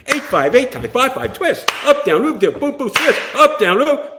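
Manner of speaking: loud, high rapid-fire dance calls; high-pitched mock drill of counts; choreographer calling counts. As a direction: call out a Fosse routine over the room. Imitates choreographer calling out routine.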